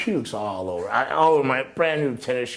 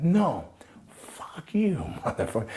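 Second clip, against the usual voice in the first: high defiant curse; retold outburst; 7 semitones higher, slower than usual. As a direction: fire it back at him. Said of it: "I went".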